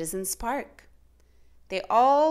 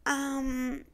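higher, stammering clerk; flustered clerk; 5 semitones higher, 4 dB louder, much slower than usual. A narrator's reading. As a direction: caught off guard, grasping for words. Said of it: "said the clerk".

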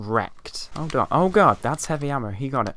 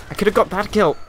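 louder, higher, protesting; higher, louder complaint about a missed kill; indignant; 7 semitones higher, 4 dB louder than usual. Real, not acted. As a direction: insist on it with outrage.

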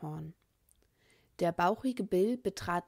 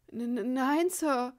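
higher, stammering in fear; 7 semitones higher than usual. A narrator's reading. The frightened boy answering Bill, stammering. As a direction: stutter out the denial. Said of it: "stammelte er".

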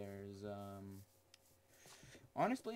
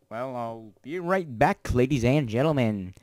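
showman announcer; 18 dB louder than usual. Pretend. Streamer announcing a break to viewers, booming showman call. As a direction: belt it out like a show host.

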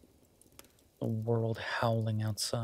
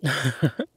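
short, louder burst of chuckling; 3 semitones higher, 7 dB louder than usual. Acted. Partner chuckles at a remark by the fire, amused. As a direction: let out a quick amused chuckle. Amused during cozy fireside roleplay.

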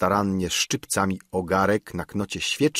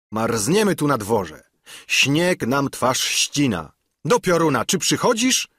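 raised, higher, impatient call; stern, threatening shout; 6 semitones higher, 3 dB louder than usual. A narrator's reading. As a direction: call out severely for a tardy servant. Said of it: "krzyknął głosem surowym i groźnym". (surowy, groźny okrzyk)

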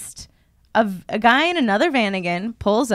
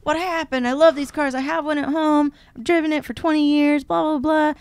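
higher, chirpy stranger voice; friendly van guy; 6 semitones higher than usual. Retold or imitated. Mimicking the helpful stranger, higher. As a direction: play the eager helper who loves these cars.

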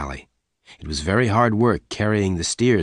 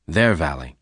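usual speaking voice

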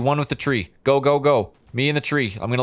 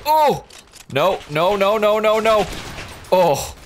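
panicked shout; 8 semitones higher, slower than usual. Real, not acted. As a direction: panic as you go down. Getting killed.

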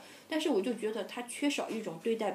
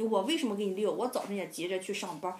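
ordinary talk

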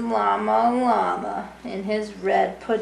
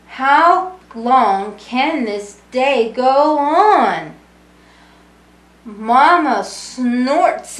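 lively sing-song storybook reading; reading to children; 2 semitones higher, 7 dB louder, slower than usual. A narrator's reading. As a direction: read it warmly to a room of kids. Reading picture book to children.

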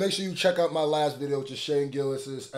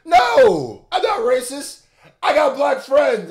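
loud, high mock protest; 11 semitones higher, 11 dB louder, slower than usual. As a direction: protest loudly, slow at the end.

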